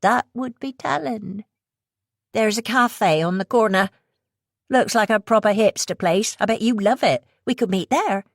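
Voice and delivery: high, teasing West Country voice; subtly mocking West Country. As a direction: needle her gently in a West Country accent. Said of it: "her subtly mocking tone".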